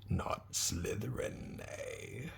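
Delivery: slow, soft, musing whisper; an old hat thinking aloud; sorting hat whisper. Acted. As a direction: weigh him up in a hush, right in his ear. Sorting hat speaks in ear.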